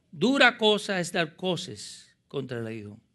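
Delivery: forceful preacher's declaration; emphatic repeat. Acted. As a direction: drive the warning home, then settle. Repeats the acted line of Jesus.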